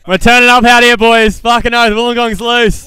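loud party hype-up; hyped-up host firing up the crowd. Real, not acted.

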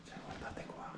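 hushed whisper, sneaking. Real, not acted. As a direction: whisper so nobody hears. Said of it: "scusate il volume".

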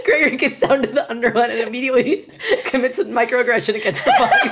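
laughing while talking; breaking up with laughter. Real, not acted. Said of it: "laughing while narrating".

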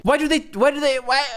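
high, strained why-why-why in disbelief; exasperated. Real, not acted. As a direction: plead at the sky in frustration. Frustrated outburst.

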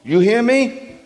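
high, loud demand; David demanding his soul's attention; commanding demand. Retold or imitated. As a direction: press the soul for an answer. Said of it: "It's like he's saying".

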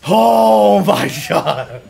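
loud, high, drawn-out cry of relief; elated yell. Real, not acted. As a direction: yell it slowly in disbelief. Celebrating the boss win.

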